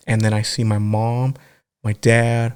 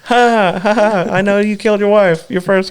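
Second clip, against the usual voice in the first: higher, fake cackling jeer; mock taunting laugh; 8 semitones higher than usual. Jeering at the parent in a lightly mocking higher laugh. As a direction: laugh like a smug tormentor.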